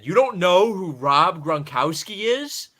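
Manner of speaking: loud, slow, incredulous; loud disbelief. Retold or imitated. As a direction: call it out in disbelief. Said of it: "I said it so loud at him".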